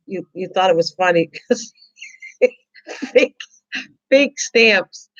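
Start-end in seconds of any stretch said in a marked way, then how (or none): 2.4-5.1 high laughing repeat of the punchline. Real, not acted. Laughing to Wendy about her fake stamps, through giggles. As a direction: giggle the words out.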